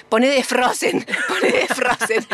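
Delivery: "Frozen" with exaggerated hard consonants, through laughter; severe consonants. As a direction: imitate a friend over-pronouncing "Frozen". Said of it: "tanta severidad en la pronunciación de las consonantes".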